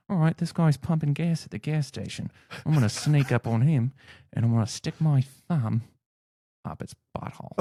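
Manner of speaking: Australian wildlife host stalking his target; mock nature-show commentary, Australian accent; Steve Irwin. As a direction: narrate the sneak-up in broad Australian, dropping to a hush. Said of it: "I would be Steve Irwin".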